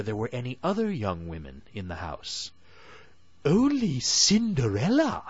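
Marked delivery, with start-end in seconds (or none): 3.4-5.2 high, loud stepsister voice; elder stepsister voice. Acted. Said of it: "said the elder sister".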